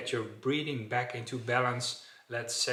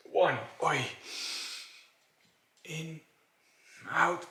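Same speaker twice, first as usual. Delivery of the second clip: struggling for breath; 4 semitones higher, 3 dB louder than usual. Shown as a demonstration. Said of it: "something like that".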